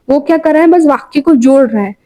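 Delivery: usual speaking voice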